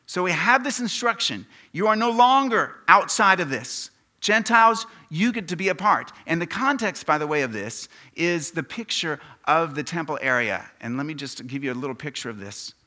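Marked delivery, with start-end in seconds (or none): 0.1-10.7 loud, raised preacher's voice; forceful preaching. Real, not acted. Preacher telling Gentiles they get to be a part, loud and forceful. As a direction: press the teaching on the whole room.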